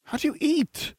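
put-on high, soft, incredulous girl; startled schoolgirl; Hermione's voice. A narrator's reading. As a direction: ask in disbelief.

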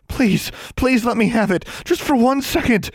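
very high, frantic pleading; desperate begging. A narrator's reading. Girl begging the custodian for the time turner. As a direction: plead at the top of your pitch. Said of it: "she begged".

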